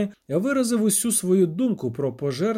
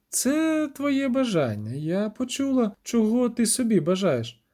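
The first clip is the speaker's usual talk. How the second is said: astonished; 5 semitones higher than usual. A narrator's reading. Sorceress realising what the witcher wished, astonished. Stunned sorceress learning his wish.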